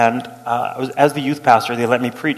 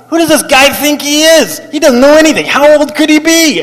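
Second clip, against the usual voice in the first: much higher and louder scornful rant; scornful parishioner; 12 semitones higher, 9 dB louder than usual. Retold or imitated. Tweed-jacket man mocking the young pastor, high and loud. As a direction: sneer it out loudly. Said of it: "this is what tipped me off".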